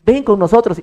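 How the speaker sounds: playful come-along; mock casual invite. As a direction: call out like waving a friend over.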